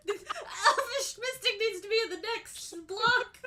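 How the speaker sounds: high imitated kid voice, half laughing; cute little voice. Kid talking about favourite card, cute high little voice. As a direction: lightly put on a small child's voice. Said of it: "He says it in this cute little voice".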